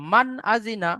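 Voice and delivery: মান আজিনা with the ন of মান said clearly, no nasal hum; teacher's model: মান আজিনা, nun without ghunna; clear izhar reading. Shown as a demonstration. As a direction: read মান আজিনা crisply, nun plain and short. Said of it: "এইভাবে আমাদেরকে পড়তে হবে".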